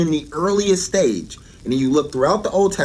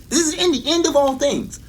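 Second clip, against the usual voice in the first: "in the end of all things" lifted much higher, stress on "in"; emphatic lift; 13 semitones higher than usual. Acted. Preacher stressing the end of all things to the congregation, emphatic high lift. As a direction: raise the whole phrase high to drive it home.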